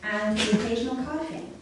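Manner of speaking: usual speaking voice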